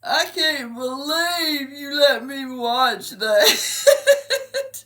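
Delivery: hammed-up high, loud, slow sobbing complaint; mock child's wail. Imitating her son upset by the film, wailing. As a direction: draw every word out like a crying little boy. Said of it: "and he goes".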